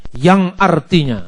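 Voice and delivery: ordinary delivery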